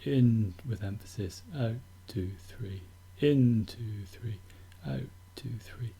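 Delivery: slow counted beats fading to near whisper; soft slow count with each three dropped away; counting the rhythm. Shown as a demonstration. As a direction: count in two three, out two three softly. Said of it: "and slow and steady".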